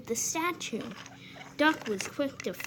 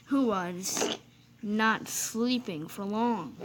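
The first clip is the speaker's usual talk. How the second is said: plain reading voice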